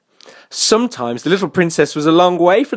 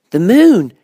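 high, loud little-bear answer; high child-like character; Baby Bear; 7 semitones higher, 7 dB louder than usual. A narrator's reading. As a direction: announce it like an excited cub. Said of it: "said Baby Bear".

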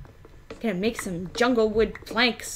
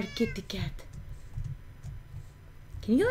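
normal talking voice

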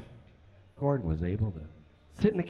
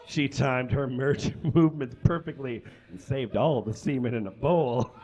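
reading through giggles; can barely get the words out for laughing; laughing while reading. Real, not acted. Reading erotic fan fiction, laughing.

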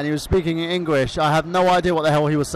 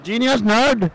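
put-on Iranian cousin accent; 2 semitones higher, slower than usual. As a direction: play the foreign cousin with a thick accent. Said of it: "Michael Burhan's Iranian cousin".

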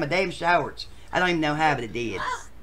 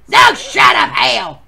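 ordinary talk, nothing marked

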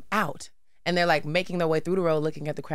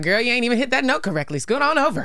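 sassy; 6 semitones higher than usual. Retold or imitated. Sassy kid pushing singer aside.

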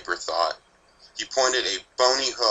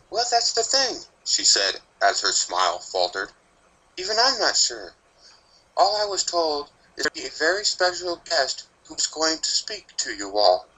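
a touch higher, gentle schoolteacher; lightly voiced teacher; 7 semitones higher than usual. A narrator's reading. Teacher telling her class about a guest, lightly voiced and higher. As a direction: play the uncertain teacher lightly.

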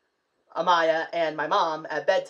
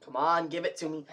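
ordinary talk

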